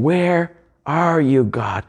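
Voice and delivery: slow, pleading cry to God, fading at the end; anguished cry. Acted. Preacher voicing Jesus on the cross crying to his Father, anguished and slow. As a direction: plead into the silence, lost. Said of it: "But he cries out in that moment".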